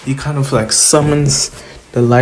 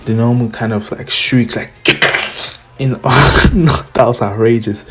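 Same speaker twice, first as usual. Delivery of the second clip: ordinary delivery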